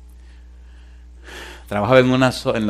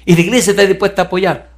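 quick, high, eager question; acted eagerness, pitched up and fast; retold excitement; 7 semitones higher, 4 dB louder, faster than usual. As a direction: imitate an excited man pressing for support. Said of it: "me dice él emocionado".

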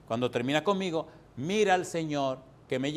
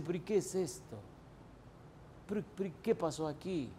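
bewildered quiet; 11 dB quieter than usual. Retold or imitated. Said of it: "Y usted se dice".

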